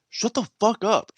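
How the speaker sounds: high-pitched mock command; fed-up drill sergeant. Drill sergeant silencing recruit, high mock-angry order. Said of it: "She goes".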